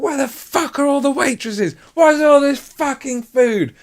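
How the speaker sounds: very high, loud furious shouting; raging boss. Retold or imitated. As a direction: scream it at the kitchen like a coked-up boss. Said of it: "He'd come out and be like".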